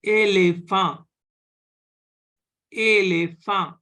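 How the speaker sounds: Elephant as French "ay-lay-fah", nasal ending; French é pronunciation. Shown as a demonstration. Teacher models an example word, Elephant with French é sounds. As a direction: say Elephant loudly, both e's as "ay". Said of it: "it will be pronounced as".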